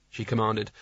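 ordinary delivery

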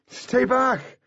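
high-pitched, urgent shout; strained cry to keep someone away; shouted warning. A narrator's reading. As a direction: shout a warning across a distance. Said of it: "Artemis shouted".

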